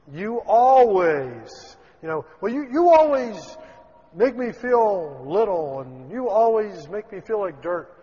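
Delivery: mock hurt accusation from an upset family member; whiny accusation. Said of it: "instead of attacking the problem".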